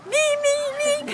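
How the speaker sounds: put-on high, eager child's "me, me, me"; small child begging the teacher to choose her. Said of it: "I must have been like".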